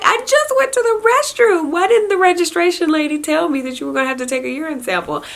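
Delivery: high, fast whiny wail; mock-wail the protest to the nurse; crying complaint. Tearful mother protesting to the nurse, high whiny mock wail. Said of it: "I start crying again 'cause I'm like".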